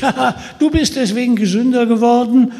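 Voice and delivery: lachend, höher und lauter; höhnisch lachend. Acted. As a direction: lach ihn spöttisch aus. Höhnisches Lachen des Michel. (Michel lacht Peter höhnisch aus, lachend)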